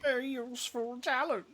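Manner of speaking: high, loud hammy villain parody. Retold or imitated. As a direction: overblown mock villain, booming. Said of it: "Villain voice".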